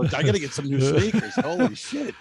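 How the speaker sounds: high, laughing exclamation; laughing at needing new sneakers. Retold or imitated. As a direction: blurt it out mid-laugh. Quoting himself, laughing.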